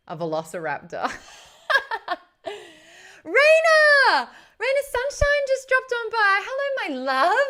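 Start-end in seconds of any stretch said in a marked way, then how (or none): 3.2-7.4 high, loud, delighted welcome; excited greeting. Real, not acted. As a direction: call her name out with joy. Greeting a friend joining stream.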